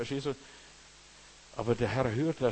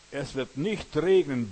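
solemn proclamation; 7 dB louder, slower than usual. Acted. Elijah proclaims no rain.

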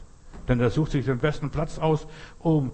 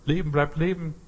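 usual speaking voice

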